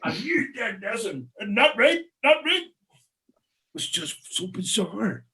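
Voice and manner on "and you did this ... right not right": mock barrister over shoulder. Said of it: "and he would say".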